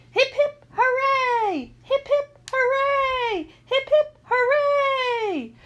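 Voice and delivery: very high, loud cheer with long hoorays; crowd cheering. A narrator's reading. Assembly replying to three cheers, cheering loudly. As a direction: cheer like a whole hall. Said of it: "The whole hall replied".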